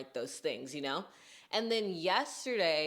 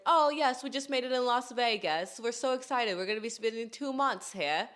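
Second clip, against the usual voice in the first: higher, shaky-attempt Australian accent; 5 semitones higher than usual. Australian girls telling her their travel plans, imitated Australian accent. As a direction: mimic excited tourists in a rough Australian accent. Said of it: "how did they talk?".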